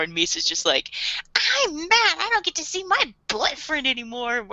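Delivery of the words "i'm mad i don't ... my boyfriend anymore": high whiny Misa. Retold or imitated.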